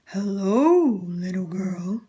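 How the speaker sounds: put-on a sly wolf hailing the girl from hiding; deep growly voice. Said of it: "said a deep growly voice".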